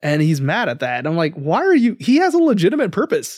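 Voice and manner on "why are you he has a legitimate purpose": mock indignation. Indignant rant at Ron's reaction.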